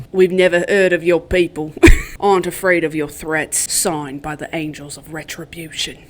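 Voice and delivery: put-on amateur British accent, reading out a threat; put-on British accent, sinking to a low close; attempted British accent. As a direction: carry on the imperfect British accent for the letter. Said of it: "try and do his accent".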